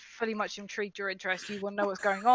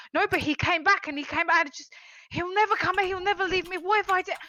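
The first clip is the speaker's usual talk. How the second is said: high, loud, frantic dwarf; agitated, can't be calmed; panicked protest; 8 semitones higher, 6 dB louder than usual. Acted. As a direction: spiral into worry, pitched right up. Agitated dwarf protests to calming companion.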